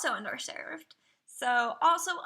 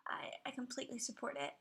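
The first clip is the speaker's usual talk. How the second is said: fast, much softer whisper; quick whisper; 11 dB quieter, faster than usual. Real, not acted. Whispered aside after sad tribute.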